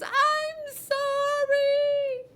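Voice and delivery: very high, loud, drawn-out crying apology; boy in tears apologizing; sobbing. A narrator's reading. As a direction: sob it out. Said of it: "burst into tears".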